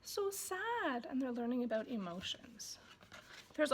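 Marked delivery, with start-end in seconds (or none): 0.0-1.1 very slow, soft and sorrowful; gentle mock sad. Shown as a demonstration. Parent teaching emotions, in a soft mock-sad voice. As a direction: show baby what sad feels like. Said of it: "you say".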